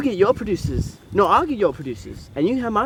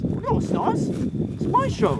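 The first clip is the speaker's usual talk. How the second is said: indignant contradiction; 13 semitones higher, much slower than usual. Acted. Acted quarrel over the show.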